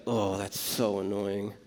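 a low, slow, exasperated grumble; annoyed muttering. Retold or imitated. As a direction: mutter it like a fed-up sister watching someone idle. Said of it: "And each time she's like".

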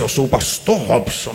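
low, drawn-out pompous title; self-important pastor. Acted. Pastor mocking his own title to the church, pompous. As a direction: puff up the title slowly, low.

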